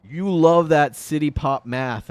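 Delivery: playfully mocking the recommendation; mock app voice. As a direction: ham up the app pitching a genre. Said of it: "And it's like".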